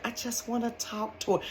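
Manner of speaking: a mock creepy stalker murmur; creepy soft-spoken man. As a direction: imitate the weird guy's smarmy tone. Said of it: "this how he said".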